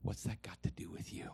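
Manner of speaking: hushed, whispered gentle rebuke; Holy Spirit. Acted. Inner voice correcting an envious preacher, hushed whisper. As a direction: whisper the question as a quiet correction. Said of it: "the Holy Spirit went".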